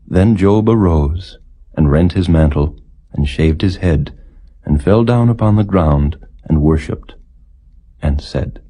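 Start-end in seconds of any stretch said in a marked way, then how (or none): none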